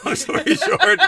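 high, laughing and slow. Real, not acted. Wrapping up the long story, laughing. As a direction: laugh your way into the wrap-up.

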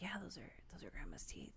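fast, hushed whisper; an aunt whispering the secret to a child; mock whispered aside. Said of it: "my aunt Terry was like".

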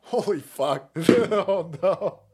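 usual speaking voice

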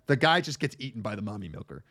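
usual speaking voice

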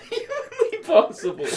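ordinary talk, nothing marked

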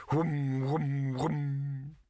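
rhythmic humming whooms in a row; droning whooms. Acted. The pot keeps wobbling, droning whooms. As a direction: hum it like machinery winding up.

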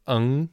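"eng" with the standard e before ng; "eng" said the standard Mandarin way; standard eng ending. Shown as a demonstration. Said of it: "the more standard way is the".

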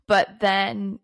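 ordinary delivery

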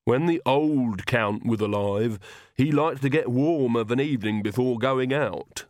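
put-on slow, lisping old retainer; lisping servant Igor, nostalgic. Servant Igor recalls the old Count, slow lisping nostalgia. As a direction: lisp slowly, savouring the memory.

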